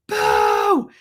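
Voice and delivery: high, loud drawn-out call; imitated holler; screaming for Bo. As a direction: yell it out to someone far off. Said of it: "I was screaming at Bo".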